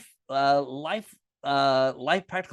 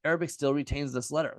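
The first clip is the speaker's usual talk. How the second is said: ordinary talk, nothing marked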